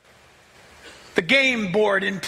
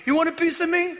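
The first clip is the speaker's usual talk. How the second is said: taunting God; 7 semitones higher, faster than usual. Acted.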